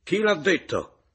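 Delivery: loud, high, slow demanding shout; shouted question. A narrator's reading. Narrator confronting the caporale, shouting. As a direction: shout it, indignant. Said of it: "gridai alla caporale".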